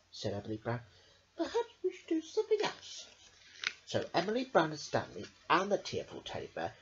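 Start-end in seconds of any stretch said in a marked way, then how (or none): 1.4-3.1 put-on high little girl voice. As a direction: reassure the teddy brightly. Emily voiced in read-aloud story.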